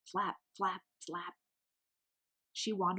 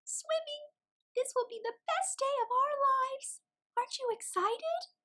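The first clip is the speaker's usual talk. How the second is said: much higher, squeaky chick voice; squeaky little penguin. A narrator's reading. Mary's held voice.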